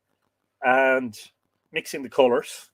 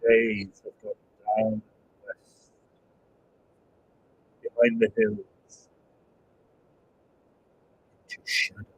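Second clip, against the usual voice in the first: solemn poem recitation; 3 semitones lower than usual. Acted. Quoting a beloved film verse to viewers, solemn recitation. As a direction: recite it gravely, letting lines fall away.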